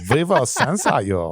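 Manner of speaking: high, slow mock-German threat; German accent. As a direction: draw it out in a comic German accent. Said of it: "in a German accent".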